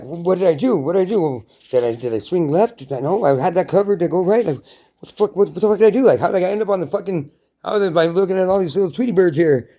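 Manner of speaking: high, flustered acted questioning; hammed-up bewilderment; dazed confusion. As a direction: puzzle it out after a blow to the head. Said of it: "you're all dizzy seeing the stars".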